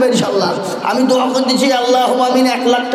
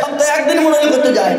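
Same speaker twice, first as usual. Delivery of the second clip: normal talking voice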